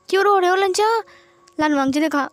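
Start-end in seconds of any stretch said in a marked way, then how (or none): 0.1-0.9 higher, anxious coaxing question; upset to find him crying; worried surprise. Acted. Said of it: "उसने आंसुओं को पोंछते हुए कहा".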